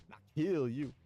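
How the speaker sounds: over-the-top dubbed thug threat; gangster threat. Acted. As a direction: spit it like a hammed-up yakuza heavy. Reads the thug's threat twice.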